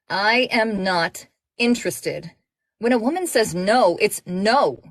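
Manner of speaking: firm refusal. Acted. Firm rejection to a flirt.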